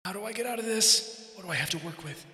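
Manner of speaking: soft, distant thinking voice; murmured to himself; inner thoughts. Acted.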